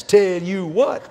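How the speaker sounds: Texan drawl. Retold or imitated. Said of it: "That's all you're getting out of a Texan".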